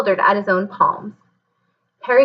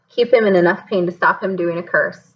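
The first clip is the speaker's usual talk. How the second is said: ordinary delivery, nothing marked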